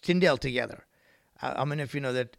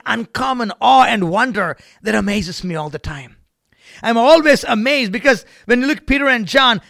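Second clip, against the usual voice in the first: loud, raised pulpit delivery; impassioned preaching; 7 semitones higher, 11 dB louder than usual. Real, not acted. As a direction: proclaim it with insistent fervor. Preaches at full force.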